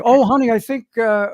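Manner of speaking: high, loud wife impression; excited wife. Wife spotting her husband on screen, excited then unsure. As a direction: call out high, then falter. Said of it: "long enough for my wife to say".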